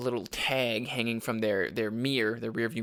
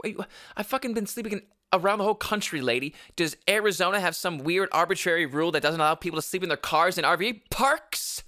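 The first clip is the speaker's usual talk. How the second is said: indignant rant; 6 semitones higher than usual. Retold or imitated. Indignant at manager's new rule.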